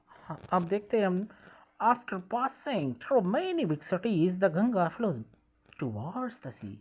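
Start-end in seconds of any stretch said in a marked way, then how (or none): 1.8-6.7 slow, careful read-aloud of English text; slow textbook reading. Shown as a demonstration. Teacher reading the textbook line slowly to pupils. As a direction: read each word out slowly for the class.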